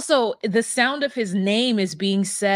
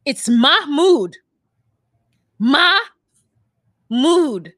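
firm, louder, slow "Mahmoud"; insistent correction; 7 semitones higher, 5 dB louder, slower than usual. Retold or imitated. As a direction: correct them with emphasis. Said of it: "I wanted him to be like".

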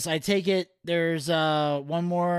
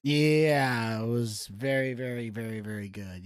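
sarcastic, deadpan; 6 semitones lower, slower than usual. Real, not acted. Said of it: "I love your enthusiasm".

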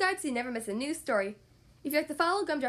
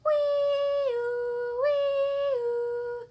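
high, loud sung siren; siren sound; 10 semitones higher, 4 dB louder than usual. Acted. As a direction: wail like a fire engine. Imitating fire engine siren.

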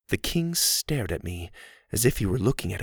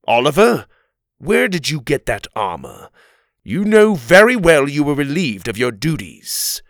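stern king; 5 semitones higher, 7 dB louder than usual. A narrator's reading. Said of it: "He looked Oliver over".

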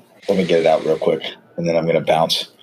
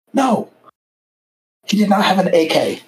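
much higher, insistent "No"; exasperated denial; 12 semitones higher than usual. Retold or imitated. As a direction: push back in disbelief. Insisting against instructor again.

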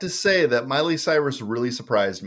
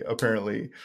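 softer, dry afterthought on apparently; dry quiet repeat; 2 semitones lower, 6 dB quieter than usual. Shown as a demonstration. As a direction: let apparently drop off under the breath. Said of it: "Yes, apparently".

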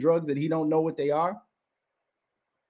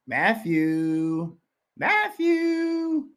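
sing-song taunting call, second one much higher; mocking call; 7 semitones higher, much slower than usual. Real, not acted. Ranting host taunts podcaster Matthew, mocking sing-song call. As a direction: drag the name out like calling him outside.

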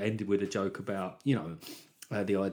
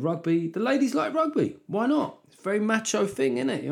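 mock high voice; 11 semitones higher, 3 dB louder than usual. Mock sing-song about rugby fans.

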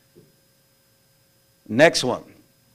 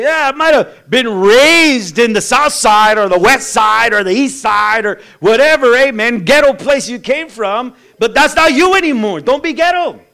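loud, high, driving sermon; full-force preaching; 6 semitones higher, 8 dB louder than usual. Acted. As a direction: hammer it home from the pulpit.

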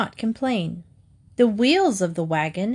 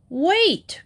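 higher sharp call; cried out; 8 semitones higher than usual. A narrator's reading. Rooster calls to the running fox, raised cry. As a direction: call after the fleeing fox. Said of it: "cried the rooster".

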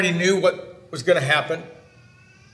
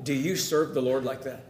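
softer, lower, one to one; quiet personal question; 6 semitones lower, 10 dB quieter than usual. Shown as a demonstration. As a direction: lean in and ask it quietly, person to person. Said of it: "I'm speaking to you".

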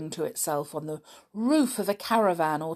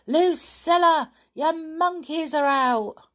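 high, loud call by name, then the news; yelling children; 11 semitones higher, 7 dB louder than usual. A narrator's reading. Camp children call to Lucilla about her monkeys, yelling. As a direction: shout for her attention, high and urgent. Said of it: "yelled the camp children".

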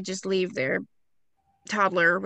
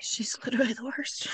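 unvoiced whispered aside; 8 dB quieter than usual. Real, not acted. Host whispers to co-host about the mother. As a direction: breathe it out as a secret. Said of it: "whispered aside".

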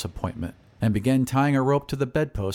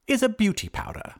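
high wizard's voice, weighing an offer; thoughtful wizard; 12 semitones higher than usual. Acted. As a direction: finish the thought in high tones. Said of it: "replied the wizard thoughtfully".

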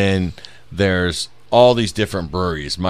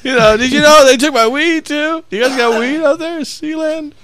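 very high, loud goofy stoner; squeaky hammed-up stoner plea; 5 dB louder than usual. Playing stoner asking about weed.

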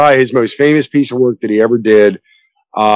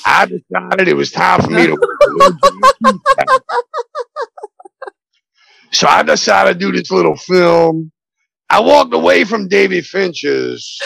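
lightly raspy Pacino voice; half-serious Pacino bit; Al Pacino impression; 4 semitones higher than usual. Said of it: "Pacino impressions".

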